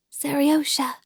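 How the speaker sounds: hushed whisper of a mother at the bedside. A narrator's reading. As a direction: breathe his name without voice. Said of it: "she whispered just in the child's ear".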